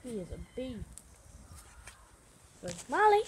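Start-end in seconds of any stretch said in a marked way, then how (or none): 2.6-3.2 high, loud call. Real, not acted. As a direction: call out for the dog. Looking for the dog.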